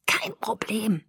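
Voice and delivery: soft, whispered rasp; chain-smoker hoarseness. A narrator's reading. Bella reassuring Jacob after nearly drowning, hoarse whisper. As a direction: squeeze it out of a raw throat. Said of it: "Stimme wie ein Kettenraucher".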